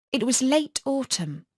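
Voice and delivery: ordinary delivery, nothing marked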